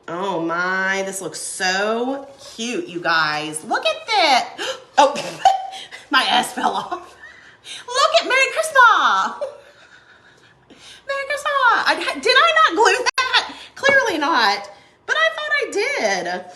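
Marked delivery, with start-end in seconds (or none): 3.6-4.8 high, loud excited squeal; delighted squeal. Real, not acted. Delighted craft reveal squeal. 5.0-7.2 gasping then laughing. Real, not acted. Letter falls off, laughing. 7.8-16.4 much higher, laughing in disbelief. Real, not acted. Sign missing letter, laughing.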